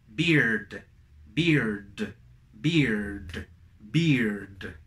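low "beard" with ee and final d. Shown as a demonstration. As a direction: close each "beard" firmly on d. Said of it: "voy a terminar con una D". ("beard" con I y D final)

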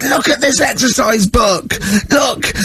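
high, slow, indignant command; outraged teacher. Retold or imitated. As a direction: thrust the book at them, voice cracking high. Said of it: "he said".

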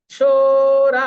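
সোরা with both vowels held long. Shown as a demonstration. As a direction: stretch both syllables of সোরা. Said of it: "সু এবং রা দুটোই দীর্ঘ হয়".

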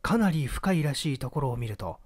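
plain reading voice

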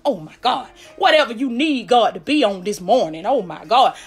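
usual speaking voice

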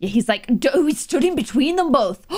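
ordinary delivery

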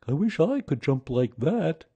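slightly high bear character; Pooh's voice. A narrator's reading. Pooh thinking, voiced.

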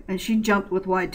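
ordinary delivery

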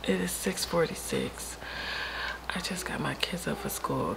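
ordinary talk, nothing marked

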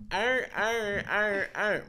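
high-pitched caricature of garbled talk; mock how we sound. Imitating the caricature of their own voices, high and silly. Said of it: "Is that how the fuck we sound?".

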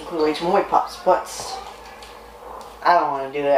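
usual speaking voice

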